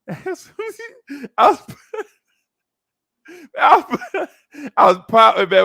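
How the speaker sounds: high, laughing through the words. Real, not acted. Telling his pouting story to a friend, laughing. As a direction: break into real laughter mid-sentence. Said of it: "laughing while telling".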